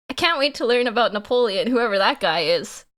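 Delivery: ordinary talk, nothing marked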